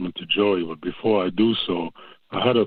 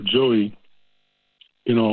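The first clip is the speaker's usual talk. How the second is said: usual speaking voice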